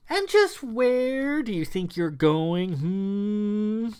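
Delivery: high, slow mocking drawl; small sly voice. Wilhela halts the fleeing heroes, high slow mocking voice. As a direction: stop them with sly menace. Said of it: "small but clearly audible voice".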